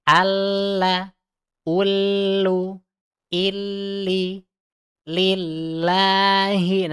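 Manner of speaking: Alla, ullu, illi, lillahi recited slow and high; drawn-out recitation of lam; thick and thin lam. Shown as a demonstration. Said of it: "tebal dan tipisnya". (bacaan lam tebal tipis)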